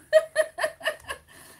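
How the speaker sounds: high, loud burst of laughter. Real, not acted. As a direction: let out a real squealing laugh. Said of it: "Just made me laugh so much".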